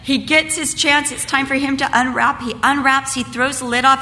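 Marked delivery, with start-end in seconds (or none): none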